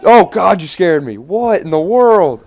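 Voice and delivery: loud startled outburst. Real, not acted. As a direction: jump out of your skin. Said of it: "I'm telling a story".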